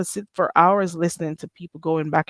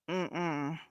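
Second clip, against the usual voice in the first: usual speaking voice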